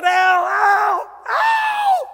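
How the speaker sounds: full-throated high shouts; hollering Al. Retold or imitated. Husband shouting for the weatherman for his wife. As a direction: yell his name over the crowd, pitch climbing. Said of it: "So I said".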